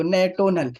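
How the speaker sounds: نیٹونل, with "tio" as "to" instead of "sh"; misread "national". Retold or imitated. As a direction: say نیٹونل with a hard t, "nay-TOE-nal". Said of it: "نیشنل کو".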